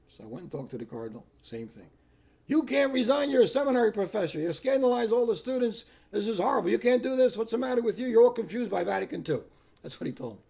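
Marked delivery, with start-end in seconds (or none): 2.5-9.4 high, loud, relentless tirade; mock-screamed run-on dressing-down; imitated screaming scolding. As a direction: berate him in a raised, outraged voice. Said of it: "they screamed at me".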